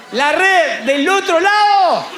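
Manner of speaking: high, slow, drawn-out call; calling from shore. Acted. Lord tells fishermen to cast the other side, slow high call. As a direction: stretch each word across the water.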